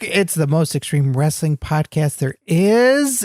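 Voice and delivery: usual speaking voice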